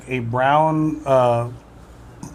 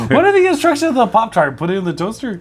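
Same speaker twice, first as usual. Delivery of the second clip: mocking; 7 semitones higher than usual. Mocking Pop-Tart instructions.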